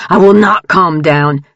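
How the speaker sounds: loud furious refusal; shouting. A narrator's reading. Jared yelling at Grant on the stairs, loud and furious. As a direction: yell it at the top of your lungs. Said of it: "Jared shouted".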